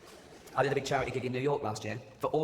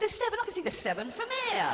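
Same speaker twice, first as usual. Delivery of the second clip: high, exasperated jab; mock Rain Man challenge, voice way up; frustrated high retort to the boy. Exasperated at the toothpicks.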